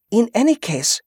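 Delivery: a wife speaking up to the group; Yvette's voice. A narrator's reading. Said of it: "Yvette said".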